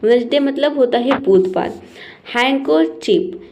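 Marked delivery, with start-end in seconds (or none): 2.3-3.4 हैंकरचीफ slowly as "hang-ker-cheef", no D; silent D. Shown as a demonstration. Teacher introduces the word, slow silent-D model. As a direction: draw out हैंकरचीफ, leaving the D out. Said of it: "इसे बोलते हैं".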